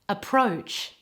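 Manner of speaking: "Approach" with all the weight on PROACH; teacher's careful "Approach", stressed at the end; stress on second syllable. Shown as a demonstration. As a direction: model "Approach", light first syllable, full gliding O in the second. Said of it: "all of the emphasis in this word is on the second syllable".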